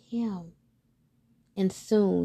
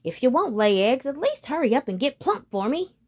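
put-on grumbling old woman; 6 semitones higher, 5 dB louder than usual.

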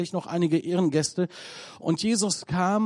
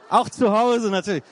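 higher, louder teasing quip; joking aside; 4 semitones higher, 6 dB louder than usual. Real, not acted. Preacher jokes to congregation, teasing. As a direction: tease the crowd with a wink.

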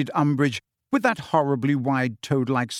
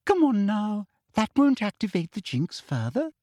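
coaxing; 8 semitones higher than usual. Acted. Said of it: "Umbridge said coaxingly".

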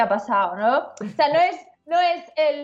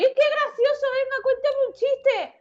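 high, slow, gushing tease; mocking Madrid local; 12 semitones higher, slower than usual. Mocking a Madrid local who asks the Andalusian for a joke, high and gushing. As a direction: squeal it like a patronising city person amused by her accent. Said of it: "tú llegas a Madrid".

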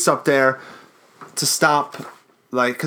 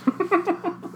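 evil cackle; 5 dB quieter than usual. Pretend. Outro host cackle.

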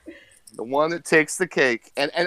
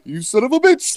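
high, loud mock outburst; imitating the old man; 10 semitones higher, 6 dB louder than usual. Old man's reply to Sal, loud hammed-up imitation. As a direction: fire back in a raised old man's voice. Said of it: "the old man's re-, like, reply".

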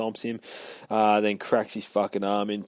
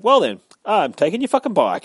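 defiant; 10 semitones higher, 8 dB louder than usual. Retold or imitated. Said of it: "And then he's like".

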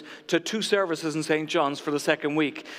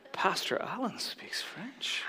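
slow, hushed whisper of mock amazement; awed whisper; 12 dB quieter, slower than usual. Congregation thinking about their pastor, awed whisper. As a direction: whisper it like an impressed onlooker.

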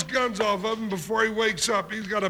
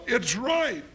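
ordinary talk, nothing marked

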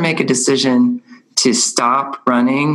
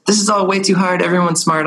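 put-on a kid's complaint, higher than your own voice; lightly voiced discouraged kid; 6 semitones higher than usual. Another kid says it's too hard, lightly voiced discouragement. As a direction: lightly imitate an overwhelmed child, pitched higher.

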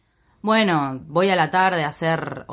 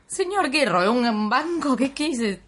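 1 stunned disbelief; 5 semitones higher, slower than usual. Retold or imitated. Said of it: "Yo me quedé como".

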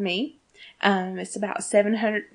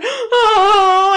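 mock happy sob, high and loud; retold tearful wail, long and high; happy crying; 12 semitones higher, 10 dB louder than usual. Fan retelling how the fic moved her, happy crying. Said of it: "I just started crying happy tears".

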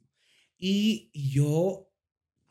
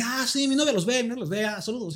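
higher, quick friendly greeting in a mimicked voice; lightly put-on fan saying hi; high-pitched mock greeting; imitating a fan; 8 semitones higher than usual. Imitating fans greeting him politely.

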